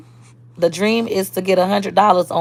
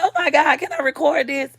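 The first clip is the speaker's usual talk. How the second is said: much higher-pitched quoted reaction; retold eager request; 8 semitones higher than usual. Asking the caller to let her record, retold high-pitched. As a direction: retell it in a raised, high voice.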